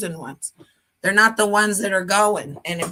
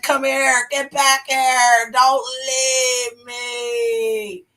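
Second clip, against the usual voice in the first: higher, dragged-out ghostly plea; clingy dead souls; 4 semitones higher, much slower than usual. Retold or imitated. Dead souls begging her not to leave, ghostly wail. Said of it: "They like".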